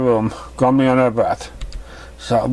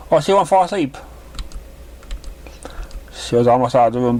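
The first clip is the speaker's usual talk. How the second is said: higher, gentle mother's voice; motherly mare voice; 7 semitones higher than usual. Acted. Mother greeting her daughter's new guest, higher voice. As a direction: play the kind mother pony.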